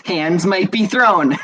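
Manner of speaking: drawn-out and laughing; amused repeat. Real, not acted. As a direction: crack up at your own threat. Laughing repeat of own threat.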